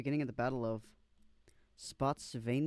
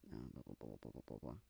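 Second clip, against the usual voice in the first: low, much softer throwaway mumble; mumbled filler; 14 dB quieter, much faster than usual. Real, not acted. Skipping the article text, low mumble. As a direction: mumble past the boring part. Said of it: "skipping text mumbling".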